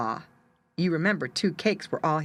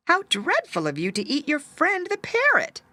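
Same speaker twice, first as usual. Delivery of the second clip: put-on high old woman, lightly scolding; a higher, mildly scandalised granny; shocked old woman; 9 semitones higher, 3 dB louder than usual. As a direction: reproach the cat in a high old lady's voice.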